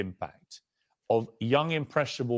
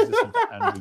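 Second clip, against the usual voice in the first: reading through a laugh; can't hold back the laugh; breaking into laughter mid-line; 5 dB louder than usual. Real, not acted.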